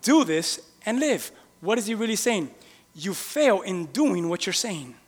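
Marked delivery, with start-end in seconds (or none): 0.0-1.3 slow, weighty "Do this and live"; a preacher's slow pronouncement; slow emphatic line. Retold or imitated. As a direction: land every word of "Do this and live" slowly.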